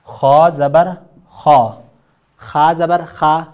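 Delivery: خا said thin and light, not full-mouthed; wrong thin kha. Shown as a demonstration. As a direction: read خا thin on purpose, the wrong way. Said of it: "غلط، تجوید کے rules کے". (غلط باریک خا)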